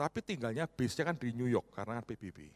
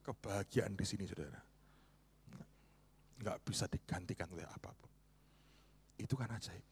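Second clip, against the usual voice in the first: ordinary talk, nothing marked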